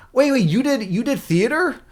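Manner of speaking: high-pitched, delighted double take; excited surprise. Real, not acted. Streamer learning a viewer did theater, surprised and excited. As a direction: burst in with an astonished question.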